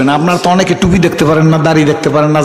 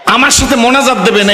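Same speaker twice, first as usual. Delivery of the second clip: called out; 7 semitones higher than usual. Real, not acted. Preacher calls out to audience.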